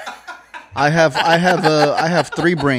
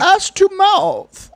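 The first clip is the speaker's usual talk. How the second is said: mock villain voice; 5 dB louder, much slower than usual. Mock quote of Bane.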